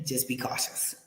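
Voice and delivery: hushed, almost unvoiced caution; a secret tip whispered to friends; whispered warning. Real, not acted. Warning viewers about scammers.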